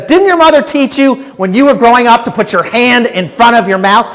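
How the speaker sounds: half-acted rebuke from a stranger; imitating her yell. A woman behind him scolds his cough, mock scolding yell. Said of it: "She yelled at me".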